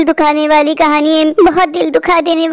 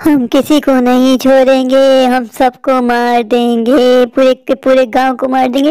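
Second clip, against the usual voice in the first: slow, low menacing witch; deep slow witch threat; 5 semitones lower, slower than usual. Acted. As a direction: loom over them as a witch.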